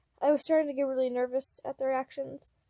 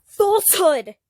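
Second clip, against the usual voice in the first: loud, higher screech of outrage; 5 semitones higher, 12 dB louder, slower than usual. A narrator's reading. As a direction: hurl the accusation. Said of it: "Logan screeched".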